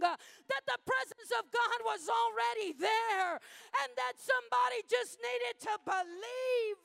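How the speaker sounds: very high, very loud shouted preaching; preaching at full force. Acted. Preacher roars the climax of the testimony to the church, full-force shout. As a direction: whip the congregation up, roaring.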